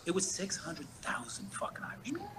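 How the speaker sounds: a hushed, conspiratorial whisper. Real, not acted. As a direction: breathe it like a dark secret. Shocking reveal told as secret.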